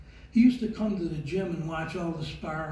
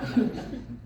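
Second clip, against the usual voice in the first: short real laugh; 6 semitones higher than usual. Real, not acted. Laughs at own story.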